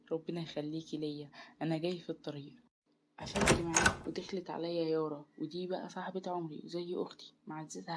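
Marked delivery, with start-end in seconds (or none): none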